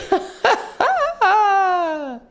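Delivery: very high, long squealing laugh; hammed-up high cackle; mock laughter. Laughing at own joke.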